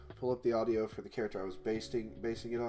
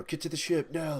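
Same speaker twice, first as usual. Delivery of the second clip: quick urgent call to get moving; a touch of alarm, hurry them along; 6 semitones higher than usual. Shown as a demonstration. Game dev demos a voice line, mildly urgent. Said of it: "say it in a way of urgency".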